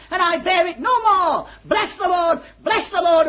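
high, rising, joyful preaching build; climbing into an exultant shout; fervent preaching. Acted. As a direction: preach it out with mounting joy. Preacher rising to full fervour.

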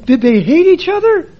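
high bewildered query; puzzled question. Retold or imitated. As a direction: ask in wide-eyed confusion. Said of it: "I said".

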